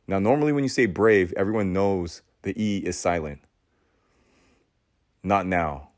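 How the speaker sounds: usual speaking voice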